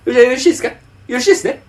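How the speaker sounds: imitating a coworker steamrolling a caller; overbearing older man. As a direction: push the caller to agree, hammed up. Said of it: "すっごい高圧的に喋んのよ".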